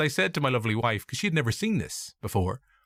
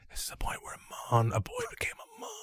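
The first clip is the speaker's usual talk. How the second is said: breathy mock-intimate whisper; hammed-up whispered solemnity; deep whisper; 6 dB quieter than usual. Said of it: "I just whispered deep into her voice".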